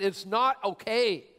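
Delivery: "not okay" drawn out, high and loud; insistent protest. Retold or imitated. Worried rider contradicting the ride operator, acted insistent protest.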